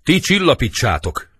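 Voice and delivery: high, loud calling out; acted urgent command; offstage order. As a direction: shout an order from outside. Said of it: "Kívül".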